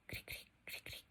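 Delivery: whispered "cric, cric, cric, cric" trailing off; cricket silence. Shown as a demonstration. Teacher explaining awkward silence to students, whispered crickets. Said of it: "quedamos así como".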